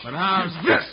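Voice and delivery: loud taunt mid-punch; taunting in a fight. Acted. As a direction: taunt him while swinging. Fistfight, throwing punches.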